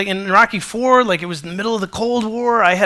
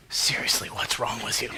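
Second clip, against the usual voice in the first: hushed, much softer mock wife; whispered; 11 semitones lower, 9 dB quieter than usual. Wife whispering to teary husband in theater, hushed. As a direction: whisper it like in a cinema.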